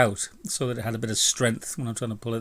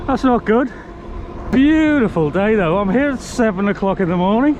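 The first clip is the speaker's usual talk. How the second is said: raised voice; 8 semitones higher, 6 dB louder than usual. Real, not acted. Talking loudly while riding.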